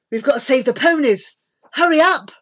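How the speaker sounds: rally them to hurry; pressing boy's call; urging on. A narrator's reading. Boy rallying friends to hurry.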